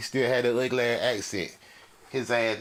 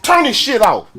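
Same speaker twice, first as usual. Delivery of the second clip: retold yell; 9 semitones higher, 9 dB louder than usual. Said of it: "I said".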